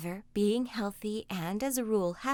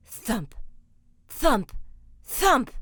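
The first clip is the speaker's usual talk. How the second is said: thumping footsteps; 3 semitones higher, 7 dB louder, much slower than usual. Acted. Ogre's footsteps approaching.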